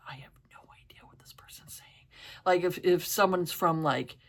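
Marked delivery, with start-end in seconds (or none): 0.1-2.1 whisper. Retold or imitated. Whispered imitated thought.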